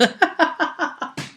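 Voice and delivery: high giggling chuckle; laughing. Real, not acted. Storyteller amused by his own game tale, high chuckling.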